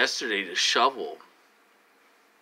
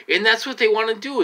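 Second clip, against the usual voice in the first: high, loud and fast accusation; agitated outburst; 11 semitones higher, 7 dB louder, faster than usual. Real, not acted. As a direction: spit it out in a sudden burst.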